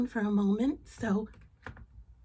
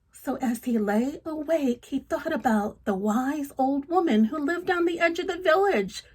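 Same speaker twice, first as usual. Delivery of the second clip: louder, lively read-aloud storytelling; story narration; 4 semitones higher, 4 dB louder than usual. Acted. Reading a folk tale to children, lively narration. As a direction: tell it with energy to a child.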